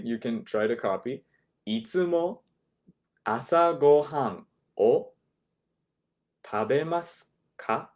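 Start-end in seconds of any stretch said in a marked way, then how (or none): 1.5-7.9 "いつも 朝ごはんを 食べますか?" said slowly, chunk by chunk, with pauses; ゆっくり区切って. Shown as a demonstration. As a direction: break it into slow pieces so they can copy. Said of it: "I'll do it slowly".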